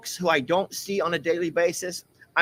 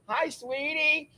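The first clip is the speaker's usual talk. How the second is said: tender greeting; 10 semitones higher, 6 dB quieter, slower than usual. Real, not acted. Man softly cooing at his pet. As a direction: coo at the animal.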